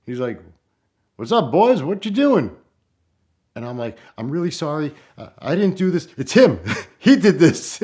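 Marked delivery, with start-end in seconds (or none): 1.2-2.6 high, loud friendly farmer hail; cheerful farmer calling from his tractor; farmer greeting. Retold or imitated. 4.2-7.8 nervous apology breaking into laughter; apologizing to the farmer, laughing. Retold or imitated.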